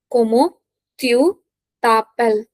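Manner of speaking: "Comment tu t'appelles" with a gap after each word; separated teaching delivery of "Comment tu t'appelles". Shown as a demonstration. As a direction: break up "Comment tu t'appelles" for learners. Teacher dictates phrase in pieces.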